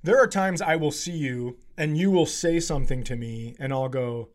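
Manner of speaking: ordinary talk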